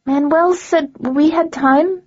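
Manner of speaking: a lightly voiced girl protesting weakly. A narrator's reading. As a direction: plead it weakly as a scared teen. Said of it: "she protested weakly".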